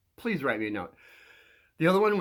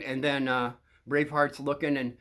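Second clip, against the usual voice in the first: ordinary talk, nothing marked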